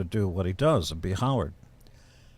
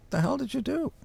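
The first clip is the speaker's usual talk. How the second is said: much higher, demanding the hell did you do; mock angry confrontation. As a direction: play your outrage, pitched up. Confronts impersonator, retold.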